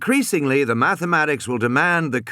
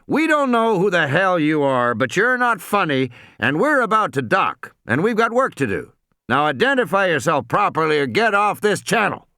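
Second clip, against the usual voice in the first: higher-pitched, irritated radio captain; mildly drawled, firm dismissal; drawled reply; 6 semitones higher than usual. A narrator's reading. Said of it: "came the drawled reply".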